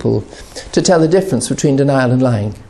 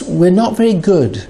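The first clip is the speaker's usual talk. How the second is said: ordinary talk, nothing marked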